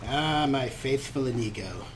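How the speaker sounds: ordinary talk, nothing marked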